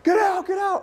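high shouted panic, get out of the water; panicked shout. Retold or imitated. Beachgoers spotting a shark, shouting in high panic. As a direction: yell at the swimmers to get out. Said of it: "people are like".

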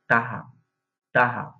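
টাহা in Barisal speech, ha instead of ka; Barisal dialect word. Shown as a demonstration. Teacher models the Barisal word টাহা. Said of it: "এটার বরিশালের ভাষা হবে".

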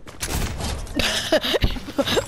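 silent breathy wheeze of laughter; wheezing laugh. Real, not acted. Gamer cracking up silently, wheezy laugh. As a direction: laugh with almost no voice.

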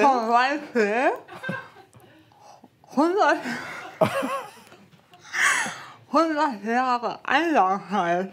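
slow, slurred with cork in mouth; mouth full. Real, not acted. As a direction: struggle to speak around a cork. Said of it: "das spricht eher undeutlich".

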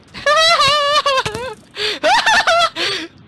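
high, loud cackling; wild laughter. Real, not acted. Laughs wildly after attacking.

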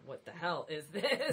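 mock critic. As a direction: play a sneering reader, half laughing. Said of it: "people going around saying".

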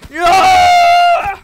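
very high screaming no; real high scream of fright; terrified shout. Real, not acted. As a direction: shriek it in horror. Monster screams at him.

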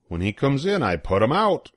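ordinary delivery, nothing marked

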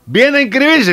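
high, loud shouted question; acted calling out. Alcoholics Anonymous members hail a drunk arrival, calling out. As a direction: call out to someone at the door.

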